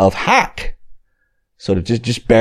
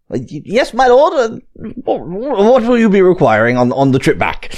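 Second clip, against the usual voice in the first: flustered colonel; 10 semitones higher than usual. Acted. GM voices flustered colonel to lord.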